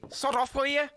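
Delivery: high-pitched Scouse character voice; high Scouse chancer; Scouse Jimmy. Acted. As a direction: squeak it out in thick Liverpudlian. Voicing Scouse Jimmy in game.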